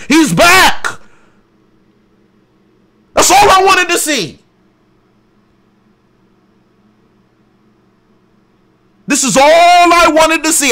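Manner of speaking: high, loud and excited, punching each phrase; hyped shouting. Real, not acted. Fan shouts at viewers that Sanji is back, high and loud. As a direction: yell it like your favourite is back.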